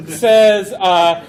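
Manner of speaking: ordinary talk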